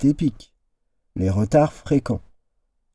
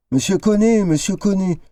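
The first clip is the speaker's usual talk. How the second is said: higher urgent calling of a name; 8 semitones higher, faster than usual. A narrator's reading. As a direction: call him back to the room. Doctor calls out to patient.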